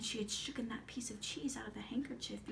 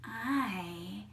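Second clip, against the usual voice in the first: put-on slow, drawn-out old witch voice; creepy old aunt welcoming her prey; Baba Yaga; much slower than usual. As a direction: relish every word, stretching it out. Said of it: "out of the door stepped".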